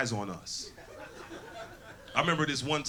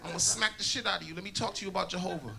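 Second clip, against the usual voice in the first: put-on old lady witness; 5 semitones higher than usual.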